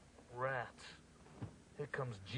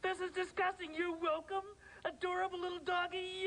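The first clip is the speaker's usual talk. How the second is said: higher-pitched sneering echo; disgusted mockery; 5 semitones higher than usual. Grouch repeats the woman's gushing words, mocking. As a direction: throw the sweet talk back with disgust.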